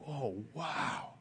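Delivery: soft breathless gasp of wonder; hushed wow. Retold or imitated. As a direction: let it out quietly, amazed. Awed at Grand Canyon edge.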